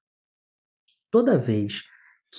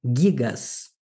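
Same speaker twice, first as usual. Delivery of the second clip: "Gigas" with extra emphasis on GI, falling after; stress on accented syllable. Shown as a demonstration. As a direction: punch GI in "Gigas", with a hard g. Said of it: "eu tô dando ênfase maior à sílaba".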